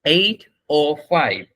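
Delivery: very slow, separated words; demonstrated time phrase. Shown as a demonstration. Teacher showing students how to say it, slow model. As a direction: draw out "eight oh five" word by word. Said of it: "আপনি এখানে এইভাবে বলবেন".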